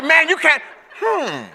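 high, laughing no; laughing refusal. Retold or imitated. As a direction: refuse him while cracking up. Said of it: "I said".

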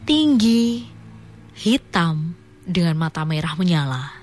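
slow, spooky story reading; story narration. Acted. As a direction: read it like a ghost tale. Reads listener's horror story.

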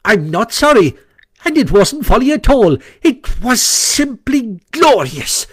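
high-pitched defiant Toad; unrepentant, stretching out the final boast; sullen but stout. A narrator's reading. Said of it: "a little sullenly but stoutly".

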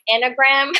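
"enneagram" attempted as EN-uh-gram; unsure pronunciation. Real, not acted. Guest unsure how to say "enneagram", tentative EN-uh-gram. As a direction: try "enneagram" in three syllables, EN-uh-gram. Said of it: "don't even know how to say this word".

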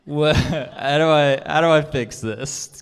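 high, loud, slow, laughing question; laughing plea. Retold or imitated. Speaker retelling asking God for help, laughing. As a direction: beg for the answer through a laugh.

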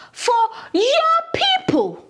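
"your" drawn out and pitched high; "your people" with a high, stretched "your"; stress on YOUR. Acted. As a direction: lean slowly on "your", disowning the people. God disowns the people to Moses.

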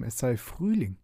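plain reading voice

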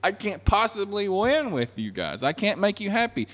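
high, pleading exasperation; put-on frustration at impossible people; acted exasperation.

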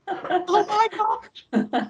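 very high, very slow awed oh my God; high drawn-out mock amazement; amazed. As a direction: stretch it out in wonder. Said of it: "it was like".

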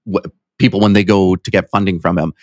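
ordinary talk

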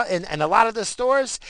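ordinary talk, nothing marked

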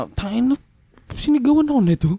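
retelling the moment his ears rang with anger; inner fury. Retold or imitated. To himself after the woman yelled, retold flare of anger. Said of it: "biglang nagpanting na yung tenga ko".

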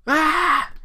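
sudden loud, high yell; mock emotional outburst, high and loud; frustrated scream. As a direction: explode in an acted scream of frustration.

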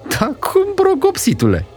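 high, playful auntie; aunt teasing her nephew. Acted. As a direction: tease the little smart aleck. Aunt teases the boy.

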